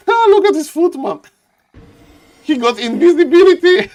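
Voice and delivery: high, loud laughing exclamation; laughing excitement. Real, not acted. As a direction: laugh at the sneaky footman. Laughing at invisible footman.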